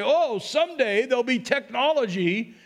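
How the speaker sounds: hammed-up marvelling at technology; mock God announcing. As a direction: play a mock-excited prophecy of the future. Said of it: "he didn't say".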